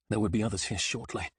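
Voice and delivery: usual speaking voice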